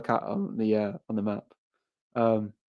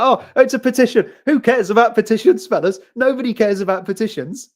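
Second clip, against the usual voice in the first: much higher, louder, mock-dismissive voice; mocking Labour; 10 semitones higher, 7 dB louder than usual. Mocking Labour brushing off the petition, in a high, loud, put-on voice. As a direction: squeak it out like a party pretending not to care. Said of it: "They'll be like".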